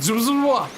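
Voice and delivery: high, loud cartoon zoom and wail; meme sound effect. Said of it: "that meme one where it's like".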